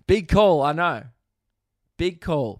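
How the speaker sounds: high and loud, owning the joke; self-mocking. Real, not acted. Comedian loudly admitting a bold claim. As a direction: shout it out, half laughing.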